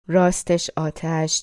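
ordinary delivery

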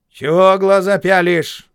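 loud, high, slow snarl; shouting. A narrator's reading. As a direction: bark it at the boy. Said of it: "крикнула мне баба".

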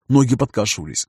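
ordinary delivery, nothing marked

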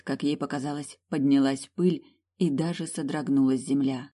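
ordinary delivery

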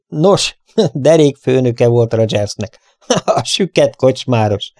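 chuckling through the words; amused laugh at the revelation. Acted. Derek laughing to his friend about the unmasked boss, laughing. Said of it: "aztán felkacagott".

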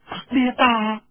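high, loud scoff; scoffing young woman. Acted. Xuanjiao scoffs at Feng's idea, high and loud. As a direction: brush the idea off.

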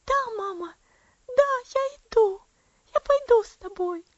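put-on high, loud, joyful child's cry; delirious girl greeting her dead mother with joy; eager exclamation. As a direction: call out happily to her mother. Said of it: "она живо воскликнула".

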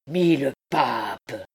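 slow, drawn-out exclamation; exclaimed oath. A narrator's reading. Clopin cursing at his baffled men, exclaiming. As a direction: swear with weight on each word. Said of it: "s'écria Clopin".

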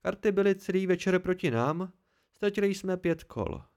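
plain reading voice

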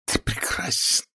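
adoring feminine whisper; hushed, seductive whisper. A narrator's reading. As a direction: whisper it high and soft to a lover. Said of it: "прошептала она".

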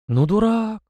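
high, drawn-out dismay; bewildered. A narrator's reading. Captain cannot believe his ears at the boy, bewildered. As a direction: marvel helplessly at such foolishness. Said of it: "растерянно покачал сотник головой".

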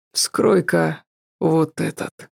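husky, slow and rough; hoarse. A narrator's reading. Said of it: "неожиданно хрипло произнёс Эльгар".

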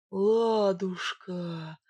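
very slow, entranced drawl; dazed, lovestruck murmur; hypnotised. A narrator's reading. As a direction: stretch the word out as if spellbound. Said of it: "как загипнотизированный повторил он".

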